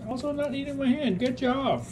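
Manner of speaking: high sing-song praise; pet talk. Real, not acted. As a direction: coo at the dog, high and bright.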